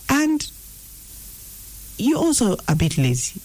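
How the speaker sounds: high-pitched voice of half-truths; insinuating inner voice. Acted. Deceiving voice speaking half-truths to you, insinuating. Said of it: "the voice can come and say".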